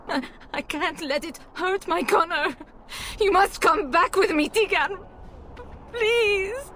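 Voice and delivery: begging, near panic; mother begging Teagan for her son; desperate plea. Acted.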